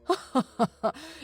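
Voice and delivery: short acted laugh in character; an amused professor's chuckle; low-voiced chuckle. A narrator's reading. Professor amused by his assistant's cheek, chuckling. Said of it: "Sorvar laughed in a low voice".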